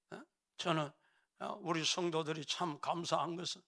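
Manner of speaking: normal talking voice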